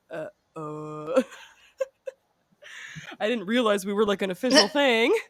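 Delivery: teary, stammering mock protest; fake-whimper like a cornered boyfriend; mocking Brendan, whiny and caught off guard; mock flustered guy.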